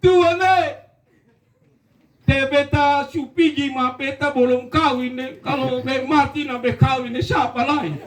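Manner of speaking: high, pleading panic; mock cry to God. Frightened recruit calls out to God, pleading. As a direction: beg God as you fall.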